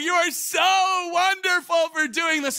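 high, loud, slow, hammed-up praise; over-the-top gushing. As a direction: shout your thanks, overdoing it. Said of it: "gushing over this woman".

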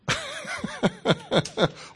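bright burst of laughter; delighted high laugh. Real, not acted. Preacher laughing with the congregation, delighted laugh. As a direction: laugh out with joy. Delighted after answering the question.

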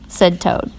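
plain reading voice

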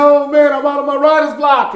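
high-pitched imitation of an elated man; excited. Excited man tells producer his block is over, excited. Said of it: "He was so excited".

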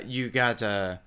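ordinary talk

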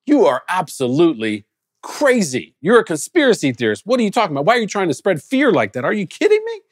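builds from plain to a very high incredulous squeal; scoffing disbelief. Retold or imitated. Mocking a pandemic warner two years ago.

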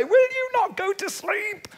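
high, pleading parent voice; mock plea to a wakeful child; exasperated parent. Preacher voicing God as a weary parent at bedtime.

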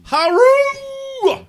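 war cry. Acted. Naming the chapter, war cry.

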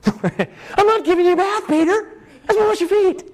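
high-pitched, comic exasperation; exasperated objection. Acted. Jesus refuses Peter a bath, comically exasperated. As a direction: raise the pitch in mock frustration.

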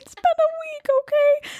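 squeaky laughing protest; high laughing. Real, not acted. Laughing defense after fumbled word.

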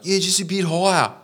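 high-pitched mock cursing; imitated griping customer. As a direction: escalate from request into hammed-up abuse. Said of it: "He's just sitting there being like".

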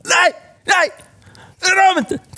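high-pitched mock shriek of refusal; put-on child's panicked cry; screaming child. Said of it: "skriker så in i helvete".

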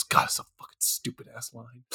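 disgusted near-whisper, barely voiced; Ben Affleck grumbling aside. Imagined actor privately cursing his awful line, hushed grumble. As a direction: grumble it very low under your breath. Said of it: "imagine Ben Affleck after he finishes saying that line".

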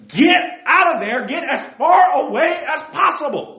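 high, loud command to flee; urgent loud exhortation. Real, not acted. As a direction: shout them out of danger.

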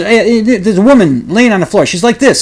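hammed-up Russian psychic seeing a vision; mock psychic, excited. Psychic describing the victim.

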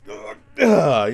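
loud, high groans of rage; angry grunting. Retold or imitated. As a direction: act out a fit of fury in grunts. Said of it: "I used to be mad for weeks".

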